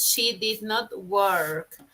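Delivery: very slow, words set apart; slow model sentence. Shown as a demonstration. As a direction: dictate it clearly.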